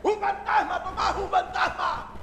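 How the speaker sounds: high, loud mock-terrified cry; panicked yelling. Frightened disciple answers a clueless Tomás, hammed-up panicked yelling. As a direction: yell it in exaggerated fright.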